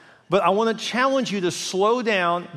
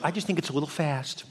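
put-on catty girlfriend; 3 semitones lower, 7 dB quieter than usual. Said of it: "All her girlfriends are like".

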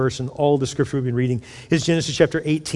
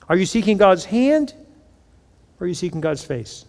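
normal talking voice

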